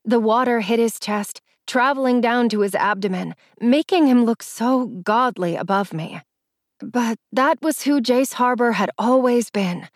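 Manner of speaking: ordinary delivery, nothing marked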